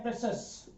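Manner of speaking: प्रेशस in two syllables, "PRE-shus", with no "ee"; two-beat प्रेशस, no "i-yas" ending; correct pronunciation. Shown as a demonstration. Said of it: "इसका शुद्ध उच्चारण, pronunciation होता है".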